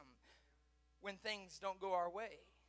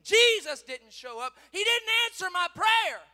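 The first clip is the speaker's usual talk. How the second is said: loud outburst climbing high; aggrieved shout; 11 semitones higher, 19 dB louder than usual. Acted. Believer complaining of unanswered prayer, aggrieved shout. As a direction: burst out in protest. Acted complaint against Jesus.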